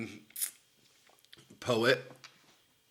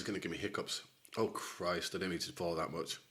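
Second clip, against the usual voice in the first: muttering under breath; 5 semitones lower, 11 dB quieter than usual. Real, not acted. Pouring too much hot sauce.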